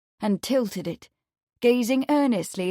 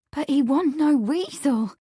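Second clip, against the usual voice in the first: tearful breathlessness; 5 semitones higher than usual. A narrator's reading. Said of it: "with tearful breathlessness".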